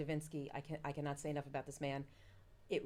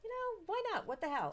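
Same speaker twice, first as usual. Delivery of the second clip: much higher, breezy shrug; carefree why not; 13 semitones higher than usual. Retold or imitated. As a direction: toss it off lightly. Deciding to try Tupperware.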